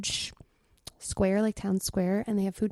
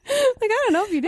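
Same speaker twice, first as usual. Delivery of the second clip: squeaky teasing voice; 10 semitones higher than usual. Pretend. Playful high squeaky teasing.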